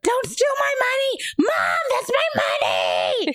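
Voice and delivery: hammed-up tantrum, wailing at mom; imitated screaming child. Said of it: "he's, like, crying and screaming".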